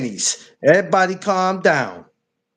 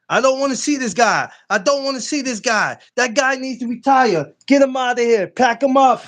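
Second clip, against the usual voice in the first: raised teasing call-out; playful high trash talk; mock hype; 6 semitones higher than usual. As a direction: hype up the crowd against him. Hyping mock dismissal of D Free.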